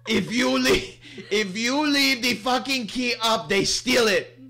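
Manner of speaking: high mock yell, laughing through it; angry Greek shopkeeper. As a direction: ham up the furious scooter guy. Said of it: "he goes".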